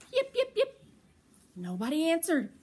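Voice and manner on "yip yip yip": high little fox yips; fox yipping. Acted. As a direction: call out like a curious little fox. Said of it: "She called".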